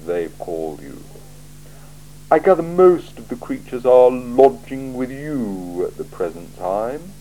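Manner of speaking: a cool ruler weighing every word; icy, deliberate interrogator; Lord Vetinari. Acted. Vetinari questions Vimes coolly.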